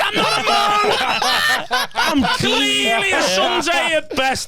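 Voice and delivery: high, drawn-out comic wail of protest; hammed-up outraged yelp; mock indignant outburst. Acted indignant retort to an insult.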